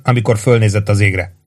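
ordinary delivery, nothing marked

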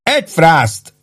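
affronted cook's exclamation; indignant. A narrator's reading. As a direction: refuse with grumpy indignation. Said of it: "mondta méltatlankodva a szakács".

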